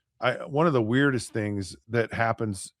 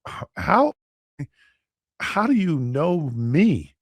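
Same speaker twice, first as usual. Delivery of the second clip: high-pitched, stammering disbelief, drawn out; stammer, then stretch the question in amazement; mock incredulous questioning; acted bewilderment; 5 semitones higher, slower than usual. Retold disbelief at being recognized.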